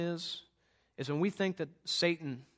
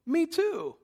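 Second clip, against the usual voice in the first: delighted surprise; 13 semitones higher, 6 dB louder than usual. Retold or imitated. Surprised shared graduation.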